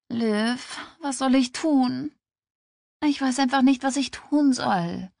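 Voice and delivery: high, pleading voice near tears; verzweifelt. Acted. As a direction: plead helplessly with a best friend. Schwangere fleht Freundin verzweifelt an. (verzweifelt, den Tränen nahe)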